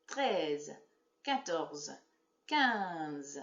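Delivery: clear, evenly spaced counting for pupils to join; counting along. Shown as a demonstration. Teacher counting with the class, one by one. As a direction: count each number with a pause. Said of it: "count along with me".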